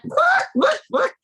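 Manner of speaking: very high, loud squawk; chicken squawk. A narrator's reading. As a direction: squawk it like a hen. Chicken noise in story.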